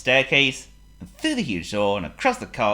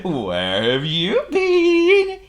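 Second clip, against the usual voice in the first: high, loud, stretched-out question; jolly cartoon character voice; grinning Mister Happy; 9 dB louder, much slower than usual. A narrator's reading. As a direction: ask slowly with a big grin, swooping upward. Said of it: "he grinned".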